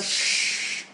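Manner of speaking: a long hissing "Shh" like shimmering chandeliers; shimmering sheen. Shown as a demonstration. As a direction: hold the sh sound long and bright. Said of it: "You know, like that".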